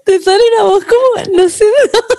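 laughing hard. Real, not acted.

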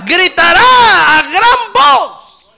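loud, high, drawn-out preaching cry; slow, full-force high shout; preacher's shout. Acted. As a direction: belt it out to the whole congregation.

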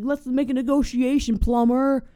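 menacing thug. Pretend. Robber calling down to the plumber, a hammed-up threatening shout. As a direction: lean in and threaten the hidden plumber.

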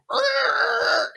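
high, drawn-out brake squeal; vocal skid sound effect; imitated screeching halt.

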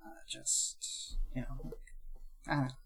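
very soft, nearly whispered embarrassment; sheepish mumbling. Acted. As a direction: trail off into a self-dismissive near-whisper. Embarrassed about admitting to a song.

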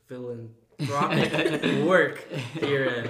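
laughing. Real, not acted. Stumbling over a hard word.

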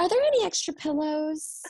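high, sweet asking voice; mock needy patient. Nurse imitating a patient's endless requests, high and sweet.

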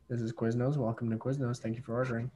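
lightly voiced counter worker greeting a caller; fast-food clerk. Voicing the Quiznos worker.

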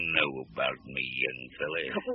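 low, slow and menacing. Acted. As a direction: drag it out as a quiet threat. Threatening gunman questions reporter.

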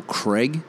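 CRAIG as "Creg"; short E in Craig, like "egg"; American pronunciation. Shown as a demonstration. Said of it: "they'll say Craig".

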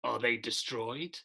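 low, soft echoing spirit voice; a hint of a ghostly voice from the dark.

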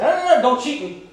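loud, high barking order; drill yell. Retold or imitated. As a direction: yell it like a drill leader at a cheater. Drill leader yelling at recruit.

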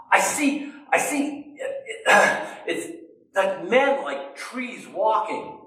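high, eager, halting at first; a healed man amazed at his new sight; excited. Acted. Blind man first seeing blurry shapes, excited. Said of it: "I bet he said it very excitedly".

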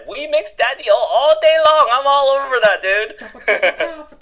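high, loud hype-man voice; excited put-on party voice; hype catchphrase voice. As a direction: belt the catchphrase way up high, playfully. Re-performing his catchphrase voice.

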